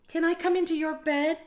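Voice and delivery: high, small pleading mouse voice; Little Mouse voice. Acted. Little Mouse asks again.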